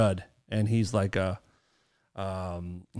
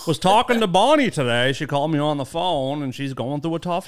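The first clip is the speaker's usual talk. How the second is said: chatty Maine local with dropped r's; Maine accent; 2 semitones higher, 3 dB louder than usual. Shown as a demonstration. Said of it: "I think I'm doing it very well".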